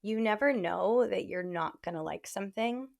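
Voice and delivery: ordinary delivery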